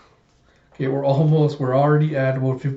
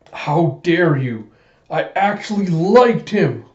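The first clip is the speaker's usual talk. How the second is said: slow, rising, higher-pitched reproach; retold indignant cry; tearful outrage; 5 semitones higher, 6 dB louder, slower than usual. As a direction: hurl a hurt accusation, drawing words out. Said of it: "tears streaming down her face".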